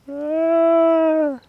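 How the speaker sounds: long, high, loud "ah"; drawn-out high cry; anguished wail. Real, not acted. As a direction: let out a loud wail of regret. Laments the lost fish.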